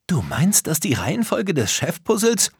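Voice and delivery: high, excited dawning question. Acted. Tom catches on to Danonino's idea, very excited. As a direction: ask it keyed up, pitch climbing. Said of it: "Tom wurde ganz aufgeregt".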